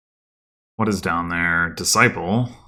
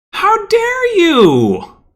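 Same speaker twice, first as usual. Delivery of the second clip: even slower, high outraged shout; mock outrage; 10 dB louder, much slower than usual. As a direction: drag out the outraged shriek. Repeated outraged outburst.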